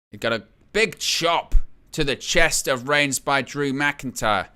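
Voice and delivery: usual speaking voice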